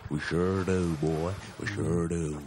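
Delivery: low-pitched American drawl impression; Matthew McConaughey. Answering a mate in character, low drawling star impression. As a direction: pitch it down like a Southern film star. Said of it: "Is that Matthew McConaughey?".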